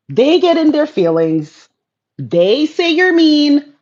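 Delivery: high, loud, building rant; excited rant. Real, not acted. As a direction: call out Dwayne and build up to a near shout. Calling out to Dwayne, building.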